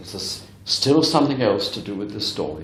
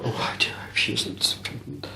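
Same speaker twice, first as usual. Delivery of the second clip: whispered thought; 9 dB quieter than usual. Retold or imitated. Medium's inner thought while greeting mother.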